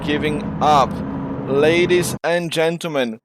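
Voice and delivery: play-by-play of the standings, energy settling; winding-down race call; race commentary. Real, not acted. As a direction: keep calling the results as the adrenaline fades. Calls finishing positions.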